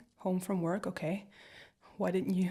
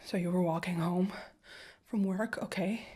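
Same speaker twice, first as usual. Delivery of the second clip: ordinary talk, nothing marked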